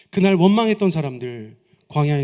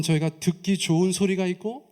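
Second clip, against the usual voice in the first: ordinary delivery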